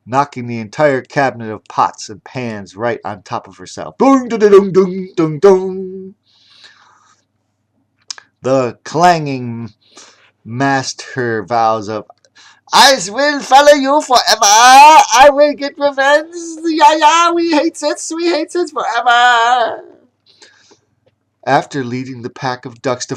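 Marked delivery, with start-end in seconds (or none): 4.0-6.1 loud high clang imitation; clanging pots. Pots falling on the chef. 12.7-19.8 vengeful chef. Acted. Chef's vows of revenge.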